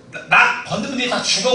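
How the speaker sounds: hammed-up schoolboy threat; mock tough threat. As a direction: bluster a threat you half mean.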